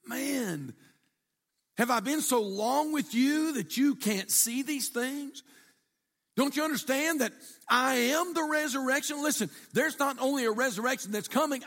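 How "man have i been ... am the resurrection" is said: higher, exasperated pleading; frustrated teacher asking why they still don't see. Retold or imitated. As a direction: voice Jesus fed up with their unbelief. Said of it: "Jesus was so frustrated".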